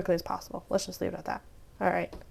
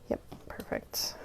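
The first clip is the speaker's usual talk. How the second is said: soft breathy whisper to herself; 11 dB quieter, slower than usual. Real, not acted. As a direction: whisper it under your breath. Whispering while crafting.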